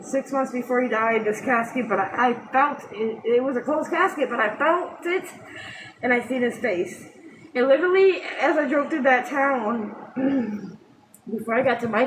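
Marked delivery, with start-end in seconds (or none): none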